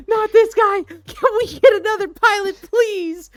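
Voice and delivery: high, loud, frantic begging; panicked passenger plea. Retold or imitated. Terrified passenger begs crew for a new pilot, shrieking. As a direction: yell at the crew for another pilot.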